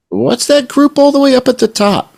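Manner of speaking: very high, squeaky mock puzzlement; puzzled onlooker's question. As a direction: ask it in a high put-on voice. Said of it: "and you say".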